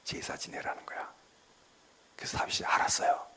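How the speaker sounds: hushed whisper with stress on 제사. Acted. Preacher telling the congregation God's command, whispered. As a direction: lean in and breathe it out softly.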